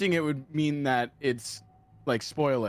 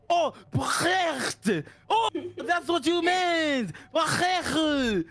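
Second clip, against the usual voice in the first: baguette high and drawn out, throaty French R; mock French realization; 10 semitones higher, much slower than usual. French friend suddenly understanding, baguette in high throaty mock French. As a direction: exclaim baguette in hammed-up French delight. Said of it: "And she's like".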